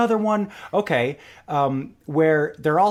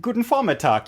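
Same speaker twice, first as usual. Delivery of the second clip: German greeting; 8 semitones higher than usual. Shown as a demonstration. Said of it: "Germans are very precise with their greetings".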